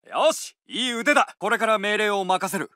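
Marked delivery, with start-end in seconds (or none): none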